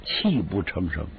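plain reading voice

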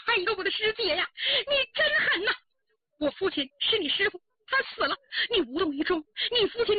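high, anguished reproach; tearful accusation. Acted. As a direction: wail at a heartless sister, drawing out the last question. Bereaved woman tearfully accuses her sister. (悲愤哭诉，高声责问)

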